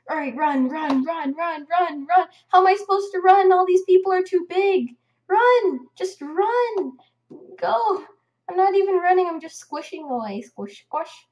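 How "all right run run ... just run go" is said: loud, high panicked urging; frantic raised-voice game panic; urgent shouting. Real, not acted. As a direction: yell at them to flee. Urging self to flee bigger players.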